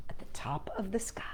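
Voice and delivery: soft fast whisper; hushed. A narrator's reading. Narrator softly trailing off the story, whisper. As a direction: let it fade into a whisper.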